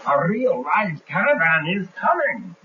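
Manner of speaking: insistent lookout. Acted. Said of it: "He says".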